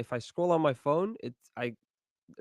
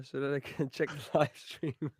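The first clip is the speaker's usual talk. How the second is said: laughing; 2 semitones higher than usual. Real, not acted. Laughing at own joke.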